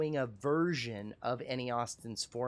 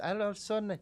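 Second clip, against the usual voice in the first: Irish accent with a lilt climbing up high; mock Irish accent rising in pitch; 8 semitones higher, faster than usual. Shown as a demonstration. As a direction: let the Irish accent go up at the end. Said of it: "it goes up like this".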